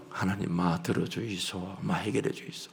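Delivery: Gyeongsang-dialect mumbled prayer; low mumbled prayer. Retold or imitated. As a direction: mutter it like a tired pastor. Retelling his own dialect prayer.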